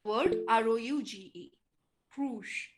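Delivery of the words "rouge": "rouge" with a curled r and soft sh ending; French-influenced r. Shown as a demonstration. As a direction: curl the tongue into "rouge", like roosh. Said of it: "The tongue curls up".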